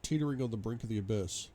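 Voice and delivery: plain reading voice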